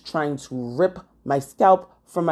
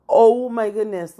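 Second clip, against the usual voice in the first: higher, louder burst of alarm; dismayed exclamation; 6 semitones higher, 5 dB louder than usual. Retold or imitated. Vlogger finding the cap stuck to her hair, alarmed. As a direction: exclaim it in mock panic.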